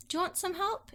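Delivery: high, light imitated offer; helpful offer. Husband offers to help with dishes, light high voice. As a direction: lilt up high like an eager helper.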